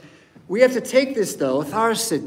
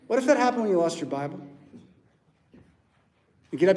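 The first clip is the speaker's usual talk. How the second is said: ordinary delivery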